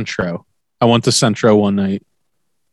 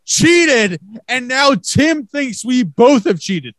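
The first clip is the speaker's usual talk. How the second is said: high, shouting indignant protest; 11 semitones higher, slower than usual. Real, not acted. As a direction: yell it like you're wrongly accused. Heated cheating argument.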